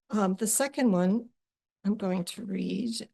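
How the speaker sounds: normal talking voice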